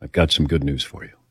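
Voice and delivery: low, soft, secretive doctor; a hint of a conspiratorial doctor; imitating the doctor's low hushed voice. Doctor sharing good news in a low hush. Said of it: "And he talked like this".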